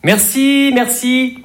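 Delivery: high, sing-out polite thanks; mock-courteous high lilt; false politeness. Shown as a demonstration. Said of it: "quand on dit, euh".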